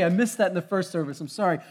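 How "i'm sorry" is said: normal talking voice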